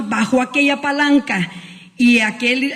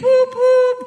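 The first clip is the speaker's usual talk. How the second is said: a high, quick imitated horn toot; a sudden high train whistle "pup"; 13 semitones higher than usual.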